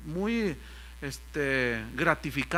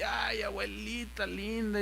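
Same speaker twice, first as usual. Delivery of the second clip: soft, slow cooing; drawn-out affectionate murmur; doting; 2 semitones higher, 7 dB quieter, slower than usual. Retold or imitated. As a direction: coo it to a dear little granny. Cuddling his elderly mother.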